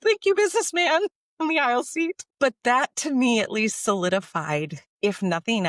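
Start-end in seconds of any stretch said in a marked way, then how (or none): 0.0-2.2 high, gushing mock gratitude; hammed-up grateful thanks. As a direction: gush your thanks over the top and up high. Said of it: "It's like".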